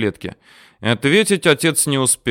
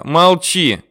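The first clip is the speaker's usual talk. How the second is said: loud, higher-pitched urgent shout; yelled command; 6 semitones higher, 4 dB louder than usual. A narrator's reading. Son tries to silence his father, yelling. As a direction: yell to shut him up. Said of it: "заорал я".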